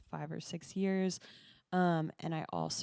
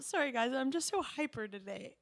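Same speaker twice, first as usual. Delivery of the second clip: middle school voice; 6 semitones higher than usual. Retold or imitated. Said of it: "that I said, like, in middle school".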